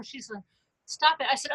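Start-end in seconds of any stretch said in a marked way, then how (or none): 0.8-1.3 put-on high little-kid "stop it"; granddaughter protesting. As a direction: mimic a toddler cutting grandma off. Voices toddler granddaughter protesting.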